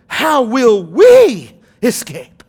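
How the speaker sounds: loud, drawn-out preacher's challenge; slow it right down and hit it hard; weighty warning. Real, not acted. Preacher pressing the verse on the congregation, weighty and loud. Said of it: "Feel the weight of that".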